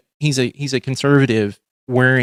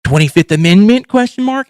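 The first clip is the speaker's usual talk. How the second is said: mock clickbait headline; 8 semitones higher, 7 dB louder than usual.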